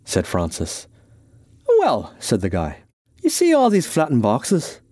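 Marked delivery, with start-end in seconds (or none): 1.8-2.1 a higher, light Irishman's voice; a hint of an explaining stranger; lightly voiced Irishman. The guy explains himself to Francis, lightly voiced. 3.2-4.8 higher-pitched, earnest character voice; Irishman, earnest explainer. Acted. Irishman explains his box plan to Francis, earnest.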